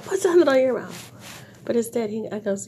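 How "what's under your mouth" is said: mock outburst. Confronting the coughing man.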